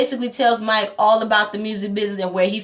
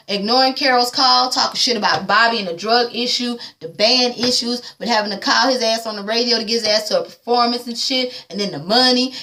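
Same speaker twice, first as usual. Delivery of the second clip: normal talking voice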